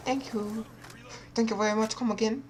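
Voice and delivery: high, mocking shopkeeper voice; mock cashier. Friend joins in mocking the teacher's thanks. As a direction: parrot the childish thanks like a clerk.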